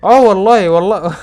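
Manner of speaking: loud, high burst of joy; excited outburst. Real, not acted. Gamer shouting at his win, excited. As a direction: shout it out in delight.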